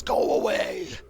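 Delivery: rough breathy monster yell; creature shout. Acted. Dirt monster warning off the horde, rough yell. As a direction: bellow like a stone creature.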